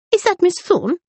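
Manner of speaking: a fussy lady checking who it is; peering matron. A narrator's reading. Mrs Buckland peers at Rosalind.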